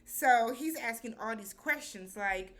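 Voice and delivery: normal talking voice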